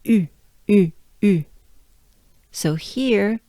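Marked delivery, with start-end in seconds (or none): 0.0-1.5 "U, U, U" with lips pushed into a tiny round opening; "U" as if blowing into a trumpet; French U. Shown as a demonstration. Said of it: "you just drop everything".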